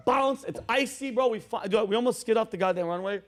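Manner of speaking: usual speaking voice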